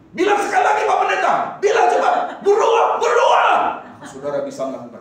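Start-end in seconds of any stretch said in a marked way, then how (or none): 0.1-3.5 much higher, loud shouted plea; eager shout. Retold or imitated. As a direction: shout it like a begging congregation. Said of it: "saudara berkata". (teriakan bersemangat, jauh lebih tinggi)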